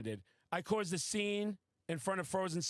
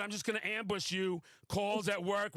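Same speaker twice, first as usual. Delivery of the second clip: usual speaking voice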